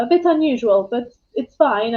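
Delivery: ordinary talk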